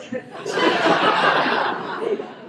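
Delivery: high, breathy burst of delighted laughter. Real, not acted. Laughs after being praised.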